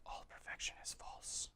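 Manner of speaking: dramatic hushed trailer-voice whisper; movie trailer whisper. Pretend. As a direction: breathe it like an ominous trailer tagline. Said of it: "Sound like a movie trailer".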